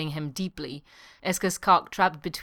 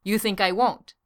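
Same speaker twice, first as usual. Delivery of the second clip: raised, higher retort; heated; 4 semitones higher, 5 dB louder than usual. A narrator's reading. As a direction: throw the question back hotly. Heated reply in quarrel.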